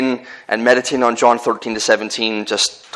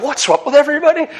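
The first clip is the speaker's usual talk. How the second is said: baffled; faster than usual. Retold or imitated. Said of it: "I'm like".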